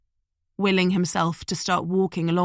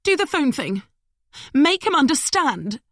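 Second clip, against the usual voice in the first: high, urgent plea; anxious woman; 9 semitones higher, 4 dB louder than usual. A narrator's reading. Madeline urging Smith, high and anxious. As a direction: press him, high and fretful.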